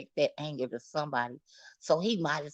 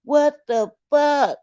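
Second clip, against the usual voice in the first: loud, high, slow outburst; slowed, high, furious shout; outraged shout; 8 semitones higher, 10 dB louder than usual. Real, not acted. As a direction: let the disbelief explode. Outraged reaction.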